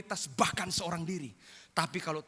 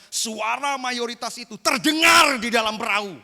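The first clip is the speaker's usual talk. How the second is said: higher, louder preaching proclamation; preaching shout; 6 semitones higher, 12 dB louder than usual. Real, not acted. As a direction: proclaim it over the crowd. Preacher's loud emphasis. (seruan khotbah yang lantang)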